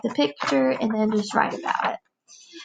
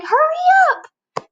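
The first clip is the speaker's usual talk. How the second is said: high, loud, slow mock yell; impatient yell; 6 dB louder, slower than usual. Teacher retelling yelling at the car in front, high and loud. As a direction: shout at the stalled car ahead. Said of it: "I kept saying".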